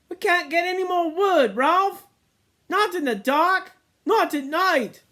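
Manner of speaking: put-on very high, loud protesting boy; pleading twins. A narrator's reading. Twins protest to Ralph about the dark, high pleading. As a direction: beg off the dark work.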